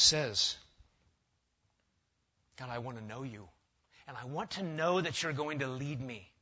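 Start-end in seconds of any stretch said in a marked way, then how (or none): 2.6-6.3 softly voiced plea to God; quiet plea. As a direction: speak low and humbly, as Moses asking God. Preacher voices Moses pleading with God.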